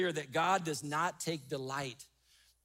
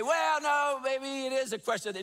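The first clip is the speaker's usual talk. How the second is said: mock objector; 6 semitones higher, 3 dB louder than usual. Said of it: "And you say".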